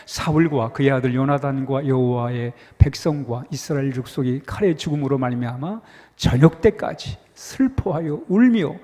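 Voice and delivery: scripture reading. Acted. Pastor leading the congregation in reading scripture, measured read. Rereads the verse together.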